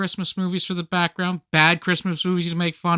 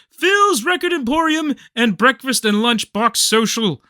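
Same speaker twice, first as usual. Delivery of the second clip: high, grand puppet-show host announcement; Lord Billington; 8 semitones higher, 3 dB louder than usual. Acted. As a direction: proclaim it like a kids' show host. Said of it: "Lord Billington, all the puppets".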